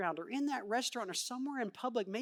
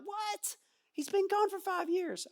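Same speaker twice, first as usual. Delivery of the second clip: high, incredulous sibling; can't believe the returning brother gets a feast; indignant disbelief; 10 semitones higher, slower than usual. Acted. Sibling indignant at homecoming dinner.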